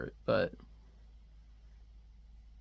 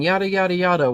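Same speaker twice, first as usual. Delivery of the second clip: higher, mock yada yada babble; mock gunman chatter; 5 semitones higher, 4 dB louder than usual. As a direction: brush off the gunman's lines. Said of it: "this guy's saying".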